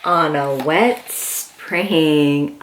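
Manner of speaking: storybook reading. Acted. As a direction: read it gently like bedtime to a toddler. Reads picture book aloud.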